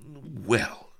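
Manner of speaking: high, stretched, severe opener; stern hesitation. A narrator's reading. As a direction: draw it out reprovingly. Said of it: "said Vanity severely".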